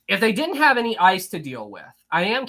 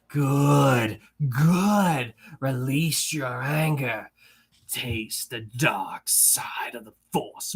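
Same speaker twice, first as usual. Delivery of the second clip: slow, low, hissing Sith emperor; Emperor impression; 3 semitones lower, much slower than usual. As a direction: menace it out like a dark lord. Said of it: "how was my Emperor impression there".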